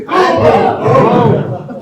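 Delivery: big high shout of boom. Shown as a demonstration. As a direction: bellow it out to lead the room. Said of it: "shout boom as loud as you can".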